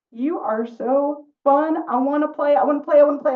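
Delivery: high, loud, eager begging; dog begging the rabbit to play; excited dog. Acted. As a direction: bounce with puppy eagerness. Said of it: "Because Dog says".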